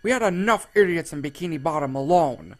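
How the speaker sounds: Squidward yelling back. A narrator's reading. Squidward yelling cruelly back at Patrick, loud and nasty. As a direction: spit the insults back hard. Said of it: "yelling at him".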